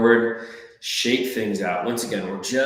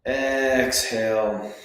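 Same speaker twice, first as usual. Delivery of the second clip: drawn-out cue; much slower than usual. Shown as a demonstration. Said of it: "cue Exhale".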